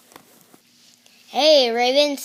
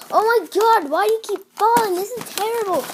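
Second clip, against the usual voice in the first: high, loud exasperated complaint; exasperated outburst; 7 semitones higher, 3 dB louder, faster than usual. Real, not acted. Scolding whatever keeps falling, high exasperated cry. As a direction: wail in frustration, pitched high. Camera or things keep falling.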